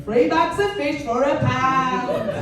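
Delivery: slow, drawn-out advert voice; voiceover ad read. Shown as a demonstration. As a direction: sell the bargain like a supermarket ad. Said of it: "It's always, like, Iceland".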